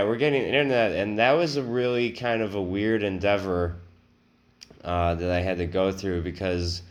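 usual speaking voice

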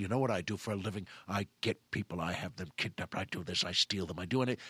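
very low, quiet menace; acted cold criminal boast; sadistic villain. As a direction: rattle off the threats low and soft. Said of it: "he becomes some sadistic person".

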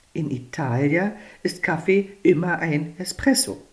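plain reading voice

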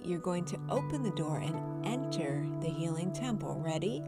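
ordinary delivery

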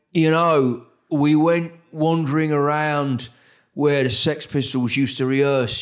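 a light London Cockney lilt, unhurried at first; Cockney accent. Retold or imitated. Rock star tells interviewer about his old street, light Cockney accent. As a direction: slip into a rock star's London Cockney, relaxed. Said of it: "he still talks like a fucking London Cockney".

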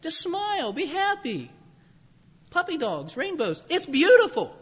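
high, sweet, soothing mock cheer; sugary false prophet. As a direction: coo it like an empty reassurance. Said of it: "or, you know".

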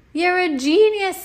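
high, slow, loud praise; admiring cry. Acted. Michael praising Ryan's swing plan, high and awed. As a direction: gush at the genius. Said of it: "said Michael".

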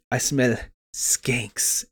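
slow, soft whispered impression; mock whisper. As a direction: whisper it slowly and pointedly. Acting out Letty's line.

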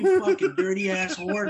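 high, loud, drawn-out retold outburst; mock angry snap. Storyteller re-enacting Jen snapping at the girl, mock fury. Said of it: "So she snaps".

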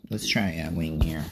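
ordinary talk

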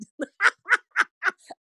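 high, real laughter; high giggling cackle; cracking up. Real, not acted. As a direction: laugh helplessly at your own rhyme. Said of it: "crack myself up all day".

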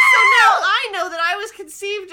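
loud laughing exclamation; laughing shout. Real, not acted. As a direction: blurt it out between laughs. Laughing shocked announcement.

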